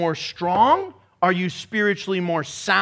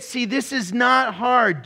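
ordinary delivery

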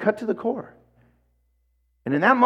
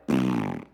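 dismissive scoff; 3 dB quieter than usual. Retold or imitated. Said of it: "I went".

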